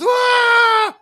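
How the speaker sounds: very high, loud shrieking scream; girlish scream. Acted. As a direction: scream like a little girl. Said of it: "Screams like little girls".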